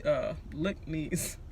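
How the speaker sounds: slow, fumbled look knees for looks like; tongue slip. Real, not acted. Vlogger laughing at her own slip, fumbled look knees. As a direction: trip over the words look knees and correct slowly. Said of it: "I always do this at every video".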